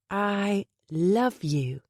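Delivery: a bird mimicking, stretched and odd; slow parrot voice. Parrot Delilah echoing Penny, slow bird mimicry. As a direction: drag each word out like a parrot.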